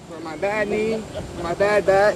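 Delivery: talking up. Real, not acted. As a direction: speak up for the officer. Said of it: "talk up".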